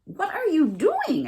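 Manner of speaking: high, slow little fawn question; curious fawn. A narrator's reading. Fawn asking the raccoon what he is doing, high and slow. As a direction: ask it wide-eyed and slow. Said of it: "asked the fawn".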